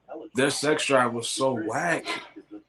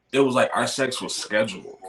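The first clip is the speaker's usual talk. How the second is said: ordinary talk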